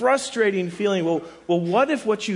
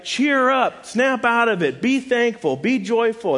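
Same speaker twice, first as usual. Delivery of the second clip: higher, louder mock order to cheer up; mock command; 6 semitones higher, 3 dB louder than usual. Preacher mimicking someone ordering a sulky friend, mock command. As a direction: bark cheerful orders in jest.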